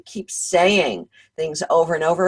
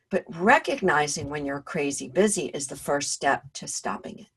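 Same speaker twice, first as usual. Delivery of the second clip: ordinary talk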